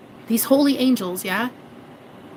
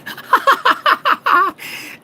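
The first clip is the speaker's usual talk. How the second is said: burst of laughter; 11 semitones higher than usual. Real, not acted. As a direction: laugh it out high. Laughing at Tina joke.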